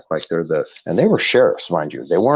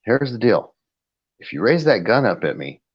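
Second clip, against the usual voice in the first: retold firm warning, pitched up; point-blank warning; 8 semitones higher, 3 dB louder than usual. Landowner retelling his warning to an armed cop, point-blank. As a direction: lay down terms bluntly, a little louder. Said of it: "I told him point blank".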